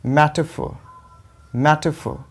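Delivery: मेटाफोर as MET-uh-fo, stressed at the start; correct, silent R. Shown as a demonstration. Teacher gives the correct reading, मेटाफोर as MET-uh-fo. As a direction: drop the r and punch MET in मेटाफोर. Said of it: "इसको बोलेंगे मेटाफोर".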